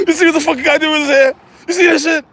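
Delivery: fast, high, loud mock outrage; mimicked agitated guard. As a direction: rattle it off in high-pitched disbelief. Mimicking security's nervous reaction.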